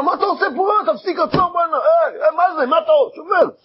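high, shocked shouting at the intruder; outraged homeowner. Acted. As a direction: yell at the man wrecking your walls. Homeowner confronts axe man. (בעל בית צועק בזעם על ההורס)

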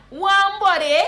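high, loud Guyanese Creole hail; Guyanese greeting. Shown as a demonstration. Guyanese teacher hailing a buddy. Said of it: "you can say".

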